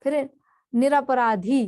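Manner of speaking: normal talking voice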